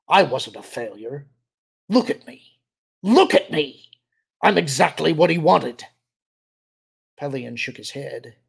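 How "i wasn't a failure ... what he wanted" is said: desperate protest. A narrator's reading. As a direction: protest erupting into high, loud cries. Rejected clone insisting he is worthy.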